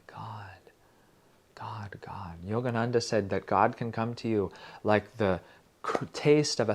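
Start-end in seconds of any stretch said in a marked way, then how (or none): 0.1-2.4 soft unvoiced whisper; whispered God. Shown as a demonstration. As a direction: breathe it inwardly. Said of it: "you mentally say".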